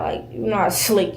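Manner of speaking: normal talking voice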